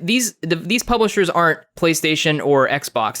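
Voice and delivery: usual speaking voice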